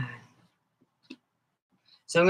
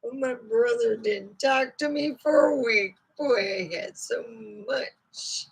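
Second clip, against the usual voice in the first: a hint of a chatty old lady telling family stories; mildly put-on folksy lady; snake lady voice; 5 semitones higher than usual. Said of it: "Let's bring out Miss Snakers herself".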